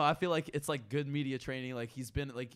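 usual speaking voice

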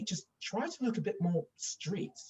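mock Queen's English lady, light and high; posh agent. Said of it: "almost like the Queen's English".

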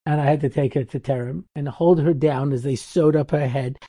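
normal talking voice

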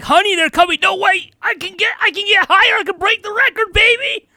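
very high, loud, giddy wife bouncing; wife lost in the thrill of jumping. Retold or imitated. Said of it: "The wife was probably like".

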